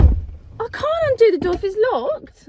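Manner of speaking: high loud exasperation; exasperated. Real, not acted. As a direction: argue back, fed up. Arguing with dad.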